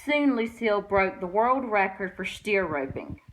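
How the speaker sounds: ordinary delivery, nothing marked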